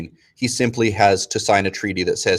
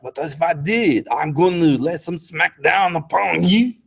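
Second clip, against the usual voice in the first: higher, swaggering mock boast; playful threat with raised pitch; cocky Louis; 7 semitones higher than usual. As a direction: taunt them like a cocky king. Louis boasts back at Europe.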